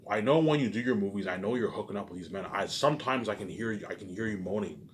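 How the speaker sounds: usual speaking voice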